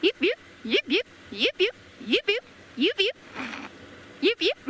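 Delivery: high yipping barks, each one rising; a playful, high animal bark repeated in short bursts; zebra call impression. Shown as a demonstration. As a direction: yip like a zebra calling to the herd. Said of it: "And I'll go first".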